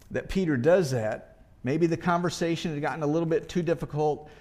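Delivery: normal talking voice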